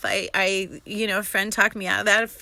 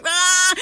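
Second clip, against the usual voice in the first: high panicked "ah"; short yelp of alarm; panicked yell; 7 semitones higher, 4 dB louder than usual. Retold or imitated. Retelling being threatened in Hebrew, panicked yell. Acts own panic in the story.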